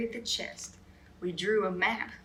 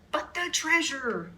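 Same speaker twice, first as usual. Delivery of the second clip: hollered; 6 semitones higher than usual. A narrator's reading. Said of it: "hollered Braided Beard".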